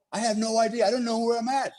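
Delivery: lost soldier panicking in the dark; panicky. Retold or imitated. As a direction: sound lost and scared. Retold lost, panicky thoughts.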